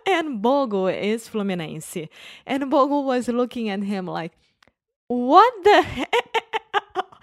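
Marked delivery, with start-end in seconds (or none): 5.1-7.1 high, loud, drawn-out shock through laughter; mock disbelief. Friend stunned by football jibe, mock disbelief. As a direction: imitate outraged amazement, laughing.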